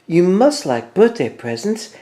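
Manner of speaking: Mouse voice. A narrator's reading. Mouse coaxing Bear.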